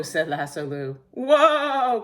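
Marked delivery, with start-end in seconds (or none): 1.1-2.0 high, loud, alarmed WHOA; high panicky cry of WHOA; cried out. A narrator's reading. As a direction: holler WHOA to halt the horse. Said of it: "cried Cowboy McCoy".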